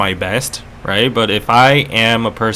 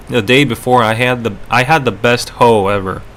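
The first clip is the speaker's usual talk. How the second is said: ordinary delivery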